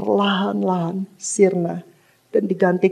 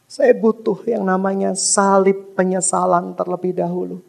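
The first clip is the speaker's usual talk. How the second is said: ordinary talk, nothing marked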